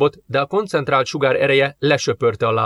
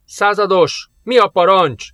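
high, louder pressing question; urgent; 6 semitones higher, 4 dB louder, slower than usual. A narrator's reading. Sergeant to captain over radio, urgent. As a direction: call out for orders urgently. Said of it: "sürgető kérdés a rádióból".

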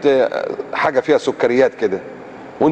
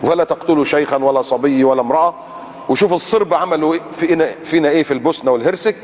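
normal talking voice

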